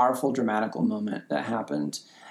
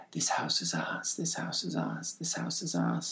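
whisper to herself; 8 semitones lower, 10 dB quieter than usual. Retold or imitated. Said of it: "she's going, to herself".

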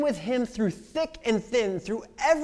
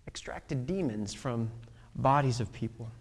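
dramatic whisper; 9 semitones lower, 6 dB quieter than usual. Acted. Hushed for dramatic effect.

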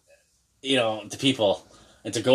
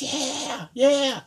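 shrill, very high mock cheer from the stands; high-pitched mock hockey-mom cheer. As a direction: ham up the gloating mom banging on the glass. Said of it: "freaking annoying freaking mother just pounding".

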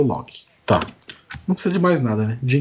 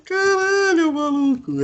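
excited outburst; slower than usual. Real, not acted. Thrilled by the Zootopia idea.

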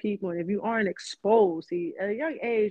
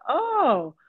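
high, louder dawning "oh"; surprised realization; 8 semitones higher, 5 dB louder than usual. Retold or imitated. Student learning about the school's demographics, surprised. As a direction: let the realization land.

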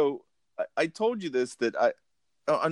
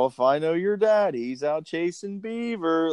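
lightly voiced dad, higher; dad's joke; 7 semitones higher than usual. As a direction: tease it like a grinning father. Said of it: "you'll hear my dad go".

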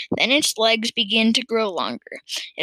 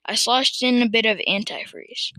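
plain reading voice

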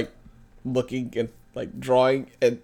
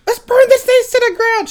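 high, loud mock war cry; impersonating a fired-up movie sidekick; imitated fiery yell; 7 dB louder than usual. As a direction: yell it high as the gung-ho friend. Imitating fiery friend from film.

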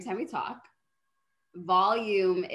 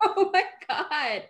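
slow, higher laughing disbelief; 5 semitones higher, slower than usual. Real, not acted. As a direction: laugh through stunned disbelief. Reacts to friend's confession.

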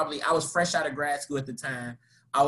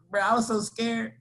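usual speaking voice

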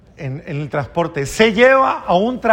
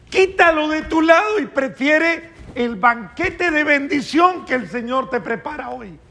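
impassioned preaching; 8 semitones higher than usual. Acted. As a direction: urge the congregation with fervour. Predicador exhorta con fuerza. (predicación apasionada y elevada)

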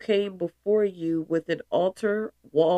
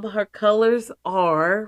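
ordinary talk

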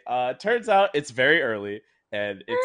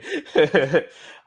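embarrassed laugh; 4 semitones higher, 7 dB louder than usual. Real, not acted.

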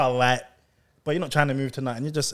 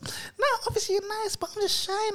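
put-on much higher, soft shy girl; 7 dB quieter than usual. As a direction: play the shy girl, up high.

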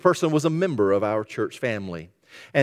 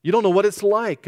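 higher, pleading objection; complaining churchgoer; 7 semitones higher than usual. Retold or imitated. Congregant protesting to the pastor, higher pleading voice. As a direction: push back at the pastor. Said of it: "You say, But Pastor".

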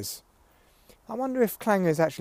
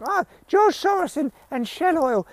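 mock activist whine; 12 semitones higher than usual. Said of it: "they're like".

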